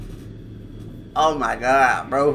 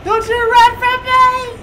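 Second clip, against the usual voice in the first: taunting; 4 dB louder than usual. Real, not acted. Chasing victim in game.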